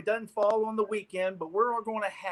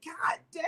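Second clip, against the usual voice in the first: mock anguished cry; 11 semitones higher, 6 dB quieter than usual. Retold frustration at being caught.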